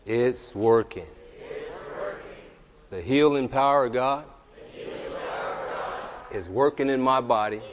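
forceful, drawn-out faith declaration; preach. Real, not acted. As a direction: proclaim each phrase loud and slow, with long pauses.

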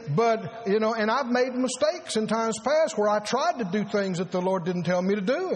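usual speaking voice

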